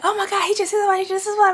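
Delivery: panicked. Retold or imitated. Panicked quote after the crash.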